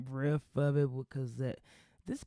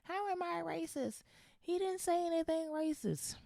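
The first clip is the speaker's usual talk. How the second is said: much higher, shrill mock indignation; lampooning a defensive TV host, much higher; mocking Sharon Osbourne. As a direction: squeal it like an offended diva. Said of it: "Sharon Osbourne's".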